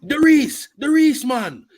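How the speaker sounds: high, exaggerated mock Jamaican accent; mock Jamaican movie accent. Friend mimicking the bad Jamaican accent from Cool Runnings, mockingly. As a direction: cry it out in a hammed-up Jamaican accent. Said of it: "What kind of Jamaican accent is that".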